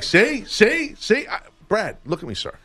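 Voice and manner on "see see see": eager pleading. Retold or imitated. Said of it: "he's like".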